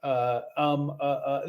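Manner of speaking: imitating an awkward excuse-maker; mock hesitant caller; halting talker. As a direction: make the excuse, then dissolve into uhs and ums. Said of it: "this is how this guy talks".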